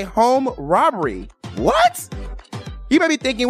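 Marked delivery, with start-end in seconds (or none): 1.6-2.1 high-pitched, incredulous rising yelp; mock surprise. Host playing listeners shocked by the teaser, mock surprise. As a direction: act out a startled listener's reaction. Voices audience's surprised reaction.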